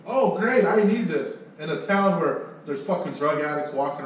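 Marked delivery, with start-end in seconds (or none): 0.1-1.4 higher and louder, delighted agreement; eager customer. Retold or imitated. Homeowner agreeing to the alarm, delighted. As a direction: sold on it instantly.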